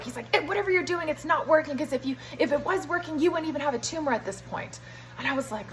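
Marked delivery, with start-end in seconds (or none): 0.4-4.8 higher-pitched imitation of a blunt, dismissive doctor; mock dismissive. Patient retells doctor rejecting her treatment, mock dismissive. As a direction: write it off, as a retold rebuke.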